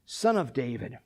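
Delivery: low, soft repeated call; subdued retold cry. Preacher retelling the beggar's cry, low and soft. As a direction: say the plea low and hushed. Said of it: "He cries out".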